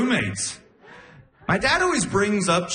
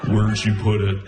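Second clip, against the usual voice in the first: low, loud, eerie menace; creepy Buffalo Bill voice; 6 semitones lower, 3 dB louder than usual. Retold or imitated. Girlfriend asking in her sleep, low creepy killer voice. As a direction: growl it like a movie killer. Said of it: "why do you sound like Buffalo Bill".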